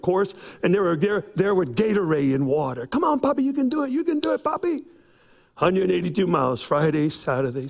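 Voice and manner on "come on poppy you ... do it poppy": put-on kids cheering. Kids cheering him on run.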